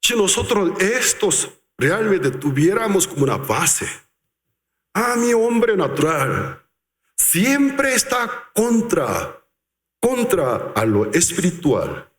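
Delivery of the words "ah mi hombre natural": usual speaking voice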